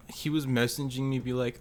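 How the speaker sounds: usual speaking voice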